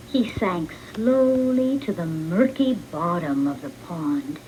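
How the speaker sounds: usual speaking voice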